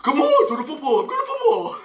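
very high, silly dim voice; hammed-up dumb jock voice; dopey dog-like jock. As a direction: act like a goofy dog of a jock to charm the girl. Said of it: "I sat there going".

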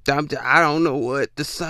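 ordinary talk

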